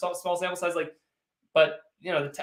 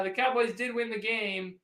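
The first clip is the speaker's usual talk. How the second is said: ordinary talk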